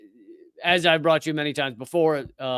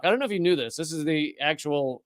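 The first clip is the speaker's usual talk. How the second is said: usual speaking voice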